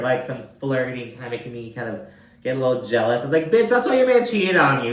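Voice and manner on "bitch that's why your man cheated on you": high, loud sassy retort; sassy clapback. Retold or imitated. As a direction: snap back like a catty friend. Sassy comeback to Lisa.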